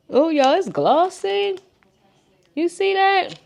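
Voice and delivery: high, slow, delighted gushing; excited. Real, not acted. Showing off the gloss.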